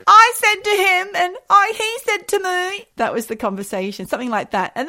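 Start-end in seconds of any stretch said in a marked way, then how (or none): 0.1-2.8 mimicking. Mimicking her own heated retelling.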